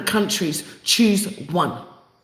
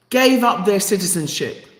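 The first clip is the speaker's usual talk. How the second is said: usual speaking voice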